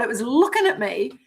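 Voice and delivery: normal talking voice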